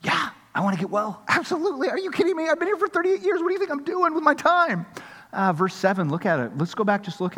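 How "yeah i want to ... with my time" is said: incredulous, much higher voice; much higher, exasperated sure-thing; emphatic yes. Retold or imitated. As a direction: play the man, eager and amazed anyone would ask. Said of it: "emphatically say".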